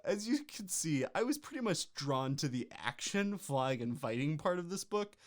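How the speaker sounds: ordinary delivery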